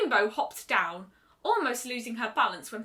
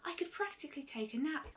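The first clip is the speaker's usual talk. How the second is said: breathy whispered brush-off; whispered, not worried at all; dismissive whisper; 4 semitones higher, 9 dB quieter than usual. A narrator's reading. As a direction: wave away the worry in a whisper. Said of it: "said dismissively".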